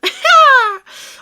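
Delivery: loud, high burst of laughter; sudden high cackle; laughing at own pun. Real, not acted. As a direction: crack up at your own joke.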